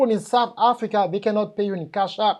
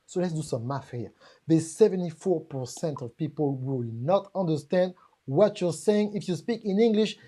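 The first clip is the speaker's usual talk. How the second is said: normal talking voice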